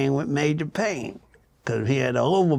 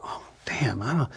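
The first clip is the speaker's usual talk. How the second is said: whispered uneasy groan under the breath; hushed uneasy aside; dismayed whisper; 8 dB quieter than usual. Retold or imitated. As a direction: whisper it like you just walked into a bad room. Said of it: "where you just go".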